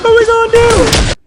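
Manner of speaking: loud, high mock of the friends. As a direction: throw their question back, yelling high. Repeat of mock yell.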